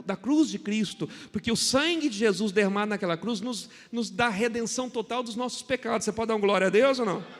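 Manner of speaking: loud, building sermon cry; fiery preaching. Acted. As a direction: preach it out to the congregation. Preaching at full force. (pregação inflamada e forte)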